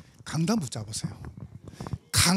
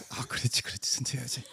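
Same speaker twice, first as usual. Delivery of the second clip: mock hushed coaching; 8 semitones lower, 8 dB quieter than usual. Wife signals him to slow.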